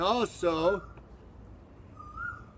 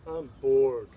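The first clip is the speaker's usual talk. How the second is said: bored student; 5 semitones lower than usual.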